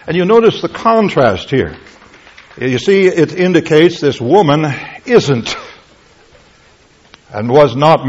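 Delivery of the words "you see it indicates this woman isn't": ordinary talk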